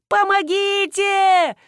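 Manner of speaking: high, loud, drawn-out cry for help; panicked cry. A narrator's reading. Woman flying helplessly into the yard, crying out. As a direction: scream it out long and high. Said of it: "закричала я".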